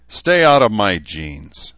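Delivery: drawn-out angry outburst; defiant shout. A narrator's reading. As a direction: shout it slowly across a square. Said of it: "suddenly shouted, his voice echoing across the square".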